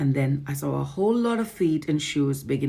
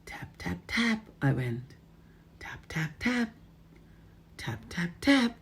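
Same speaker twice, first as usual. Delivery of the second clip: whispered taps; 4 semitones higher, 4 dB quieter than usual. Acted. Tapping quietly at door.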